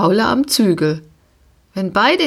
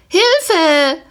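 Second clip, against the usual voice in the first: high, louder cry for help; screaming for help; 10 semitones higher, 4 dB louder than usual. A narrator's reading. Girl stuck on the pony screaming for help. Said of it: "schrie Pia".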